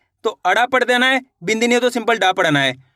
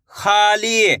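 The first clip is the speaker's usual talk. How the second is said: खाली stretched out, both vowels held long; sounded-out joining; much slower than usual. Shown as a demonstration. Teacher joins the letters for learners, खाली drawn out. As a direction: draw out खाली as two long joined syllables, खा-ली. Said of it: "एक अक्षर का उच्चारण करके इनको आपस में मिला के".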